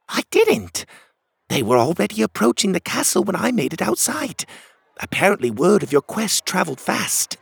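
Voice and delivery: high-pitched character voice; high, animated storybook friend; explaining Cogleen. Acted.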